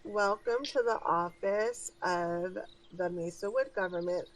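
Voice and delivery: rote receptionist greeting in an altered voice, lifting at the end; somewhat robotic. Acted. Receptionist greets arriving visitors by rote, somewhat robotically. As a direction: recite it like an automatic front-desk script. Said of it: "She says somewhat robotically".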